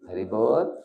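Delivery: drawn-up, rising loving greeting. Shown as a demonstration. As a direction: let the greeting lift lovingly at the end. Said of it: "like that you should say".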